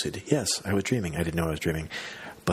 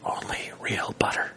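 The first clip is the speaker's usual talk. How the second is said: breathy secret whisper. Retold or imitated. Maria Bamford confiding the butter secret in a whisper. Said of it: "Marie whispered".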